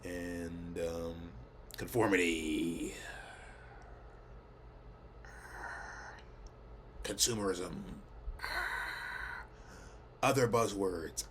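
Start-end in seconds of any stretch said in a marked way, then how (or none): 1.8-11.2 slow, drawn-out joke buzzwords; mock solemn. Vlogger spoofing social critique with buzzwords, mock solemn. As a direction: stretch each word out in mock gravity, long pauses between.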